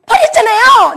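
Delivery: high, loud accusing shout; indignant retort. Retold or imitated. Preacher confronting a gangster over litter, high indignant shout. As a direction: shout it back at the offender.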